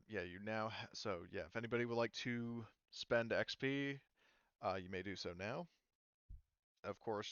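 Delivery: ordinary delivery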